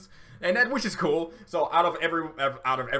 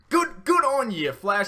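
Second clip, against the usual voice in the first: emotional praise; 10 semitones higher, 6 dB louder, slower than usual. Real, not acted. Moved, praising the character.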